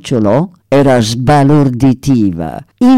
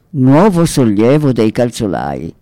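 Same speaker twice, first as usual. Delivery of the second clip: plain reading voice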